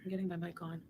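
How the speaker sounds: faint voice, mic off; barely audible. Real, not acted. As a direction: far from the microphone. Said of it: "I can't hear you".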